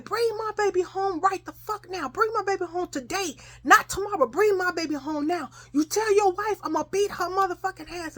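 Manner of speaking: high, insistent angry demands, acted; mother going off. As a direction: rant like a furious mother without raising volume. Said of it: "so my mama going off".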